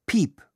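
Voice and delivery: high, short imitated beep; high-pitched mimicked tape tone; answering-machine beep. A narrator's reading. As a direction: squeak out a single electronic beep. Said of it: "kam es vom Band".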